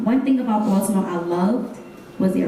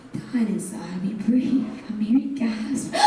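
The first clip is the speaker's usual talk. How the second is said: poem delivered with building intensity; spoken word poem; 5 semitones higher than usual. Acted. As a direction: perform it like a slam poem to a crowd.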